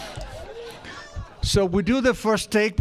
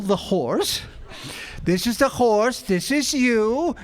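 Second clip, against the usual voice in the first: ordinary talk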